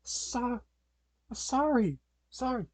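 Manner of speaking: high-pitched, stammered apology; timid apology. Acted. Hostage apologises to the robber.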